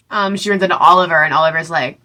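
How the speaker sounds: ordinary talk, nothing marked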